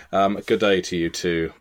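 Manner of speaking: "good day" in a crisp Southern English accent, each word separate. Shown as a demonstration. Greeting the Australian guest in plain Southern English. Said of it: "with all the inflection of a Southern Englisher".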